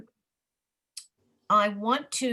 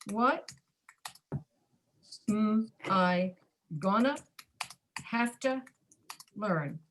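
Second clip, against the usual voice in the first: each chunk of "What am I gonna have to learn?" set apart with pauses; slow broken-up reading; slower than usual. Shown as a demonstration. Teacher slowing the reduced phrase for students, slow and spaced. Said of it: "but I reduced".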